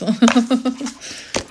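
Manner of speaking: high, bubbly run of laughter; helpless little giggling fit; real giggle. Real, not acted. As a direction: burst into giggles for no clear reason. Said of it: "I don't know why I'm laughing at that".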